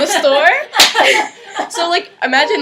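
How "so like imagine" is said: real laugh spilling into words, louder. Real, not acted. Telling friends about the yelling boss, laughing.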